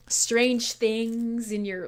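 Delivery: drawn-out hushed words; slow mock ASMR. Streamer parodying ASMR to chat, very slowly.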